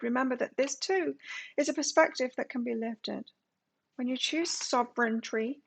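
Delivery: lifted, emphatic reading voice; oracle card reading. Real, not acted. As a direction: read the guidance out clearly, phrase by phrase. Reads oracle card text aloud.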